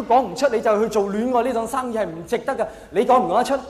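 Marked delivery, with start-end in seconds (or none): none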